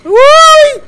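startled yelp. Real, not acted. Startled in game.

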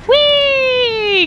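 high, drawn-out squeal; high gleeful whoop; gleeful whee. Real, not acted. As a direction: squeal as if on a slide. Drops down in the game.

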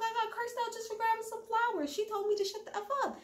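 imitating a whiny son. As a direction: tell mom what happened like a hurt teen. Jax complaining to his mother.

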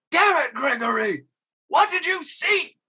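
exasperated shout. Retold or imitated. Newscaster actor fed up with Gregory, high mock yell. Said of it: "finally he's like".

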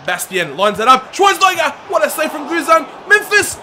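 high, loud commentary yell; excited shouting. Real, not acted. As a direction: shout at the chance. Near-goal chance.

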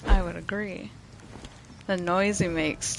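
ordinary talk, nothing marked

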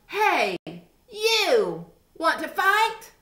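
high, loud squeaky challenge; grouchy ladybug. A narrator's reading. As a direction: pick a fight in a tiny high voice. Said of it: "the grouchy ladybug said".

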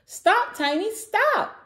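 high, loud alarmed cry; hammed-up high-pitched plea; yelping. A narrator's reading. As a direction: yelp it in storybook alarm. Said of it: "yelped Velo".

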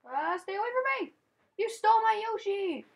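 high, fast panicked shout; frantic yell. Real, not acted. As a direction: yell after the runaway Yoshi. Yoshi runs off in game.

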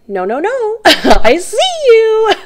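high, loud laughing no no no; laughing mock refusal. Reader voices viewer laughing off intruder, high and loud. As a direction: laugh off someone's bad energy, pitched high. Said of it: "And you're like".

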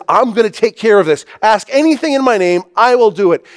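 high, loud, fast assurance; emphatic. Retold or imitated. Preacher paraphrasing Jesus' promise to take care of it, emphatic. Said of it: "amazingly emphatic".

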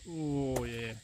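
ordinary delivery

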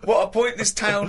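high, loud squeal through laughter; incredulous laughing shriek, easing slightly; laughing exclamation. Real, not acted. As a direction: squeal it helplessly, cracking up. Mocking story while cracking up.

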